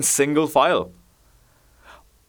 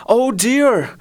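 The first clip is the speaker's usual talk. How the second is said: higher moan; 11 semitones higher than usual. A narrator's reading. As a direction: lift "oh dear," into a high plaintive moan.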